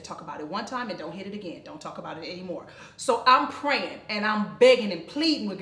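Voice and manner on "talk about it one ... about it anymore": softer, lower, steady counsel; mother calmly advising her daughter, soft; calm advice. Retold or imitated. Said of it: "she said".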